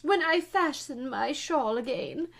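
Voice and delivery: high, loud groaning complaint; groaned out. A narrator's reading. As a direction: groan it as the suffering Queen. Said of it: "the poor Queen groaned out".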